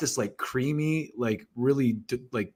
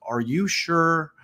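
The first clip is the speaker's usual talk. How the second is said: doubtful waiter; 5 semitones higher, slower than usual. Retold or imitated. Staff doubting his spice choice.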